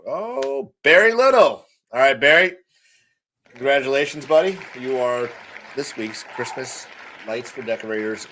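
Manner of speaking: high, loud winner reveal; hyped giveaway announcement. Real, not acted. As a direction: call out the winner like a game-show host.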